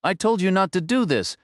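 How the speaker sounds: high, furious shout; angry shout. A narrator's reading. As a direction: yell it at the boss in a rage. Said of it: "he screamed".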